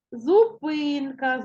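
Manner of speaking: Зупинка slowly, with a long stressed ПИ. Shown as a demonstration. Teacher changing the word to check, Зупинка slow with ПИ stressed. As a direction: stretch the И in Зупинка. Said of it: "то наголос буде падати на і".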